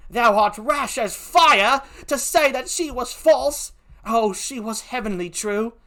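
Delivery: put-on slow British-accented protest; poor British accent. Emilia insists she was true, impassioned British accent. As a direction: plead her innocence in an amateur British accent. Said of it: "forgive my poor British accent".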